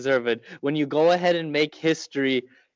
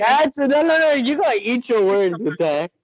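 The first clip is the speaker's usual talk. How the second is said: raised voice, high and loud; loud protest; 9 semitones higher, 6 dB louder than usual. Real, not acted. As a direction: shout him down in protest. Friend protests the NC State jab.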